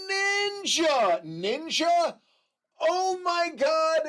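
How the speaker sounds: high, loud delighted gasp of a name; light up at seeing a friend; excited surprise. Real, not acted. Streamer spotting a friend in chat, excited surprise.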